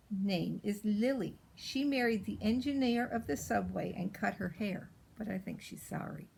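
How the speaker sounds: plain reading voice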